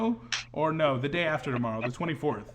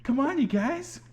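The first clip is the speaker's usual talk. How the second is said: mock actor voice; 10 semitones higher than usual. Continuing the movie-star impression.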